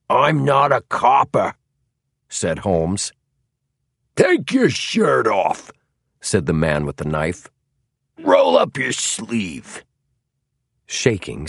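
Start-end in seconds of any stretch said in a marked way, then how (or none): 0.1-1.5 put-on loud, high Philadelphia American accent. A narrator's reading. Holmes denying he is police, Philadelphia American accent. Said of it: "in his best Philadelphia American accent". 4.1-5.7 slow, high-pitched command; tough order. A narrator's reading. Knifeman ordering the suspect to strip, tough order. 8.2-9.8 slow, high-pitched command; tough order. A narrator's reading. Knifeman ordering the suspect to strip, tough order.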